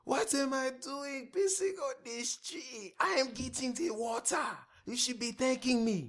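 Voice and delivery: very high, indignant mock rant at a cop; hammed-up comedian impression. Friend playing the comedian arguing with the officer, high indignant mock rant. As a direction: squeal out the outraged foreigner's defense.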